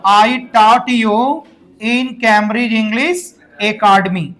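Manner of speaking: very slow, word by word with pauses; slow model sentence. Shown as a demonstration. Teacher to students, modelling the full sentence very slowly. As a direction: lay each phrase down slowly for learners. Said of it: "फिर से बोलो".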